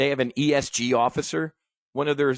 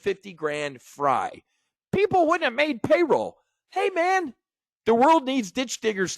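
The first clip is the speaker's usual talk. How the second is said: a lightly voiced, much higher, smug corporate raider; Gordon Gekko; 8 semitones higher than usual. Host switches to Gekko to mock the bailout, smug and higher. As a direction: sneer it out like a ruthless Wall Street tycoon. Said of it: "let's transition from being Wiz to being Gordon Gekko".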